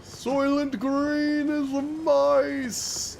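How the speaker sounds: mock dramatic cinema scream; hammed-up movie shout. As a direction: wail it like the film's desperate hero. Repeats the parody line.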